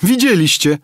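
very high, loud squeal; squealing girl. A narrator's reading. Amritsar girl squeals to the passengers, very high mocking squeal. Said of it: "zapiszczała dziewka".

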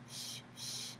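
long soft shh sounds; whispered shushing. Acted. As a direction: shush like hushing a baby. Hushing part of the song.

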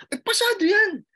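imitating the guard's surprised joy; guard cheerfully telling the crying examinee he passed; excited exclamation.

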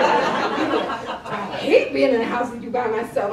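loud, drawn-out grumble with laughter; mock complaint. Client complains about his teasing chef, loud and laughing. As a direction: complain it loudly and slowly, amused.

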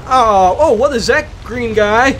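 usual speaking voice

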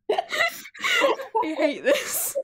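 high, very slow, cracking up; laughing. Real, not acted. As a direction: groan it out mid-laugh. Player laughing at the joke.

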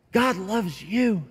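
slow and soft after the shouting; preacher gently tells the congregation, slow and tender; tender close. Acted.

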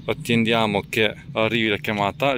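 ordinary delivery

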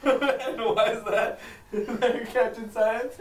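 loud, high, slow words through laughter; real helpless giggling fit; laughing hard. Real, not acted. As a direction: barely get the words out for laughing. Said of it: "I'm laughing so hard".

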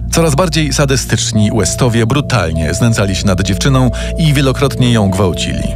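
usual speaking voice

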